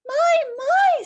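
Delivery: high, loud delighted "my, my"; surprised Winnie. Acted. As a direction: gasp it way up in wonder. Winnie voiced in read story.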